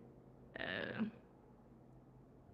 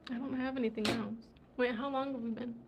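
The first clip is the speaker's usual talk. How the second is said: ordinary talk, nothing marked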